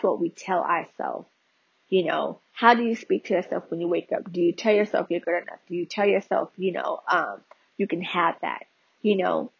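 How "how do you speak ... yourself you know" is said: usual speaking voice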